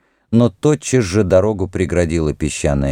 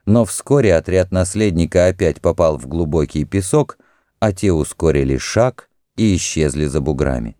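ordinary delivery

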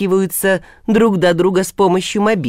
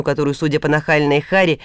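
plain reading voice

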